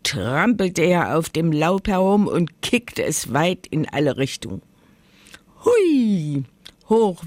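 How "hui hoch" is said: high, gleeful exclamation; whooshing, high-pitched whee; playful whee. A narrator's reading. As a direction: call out as leaves fly. Bear kicks leaves into the air.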